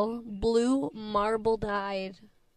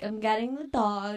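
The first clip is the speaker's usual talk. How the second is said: ordinary talk, nothing marked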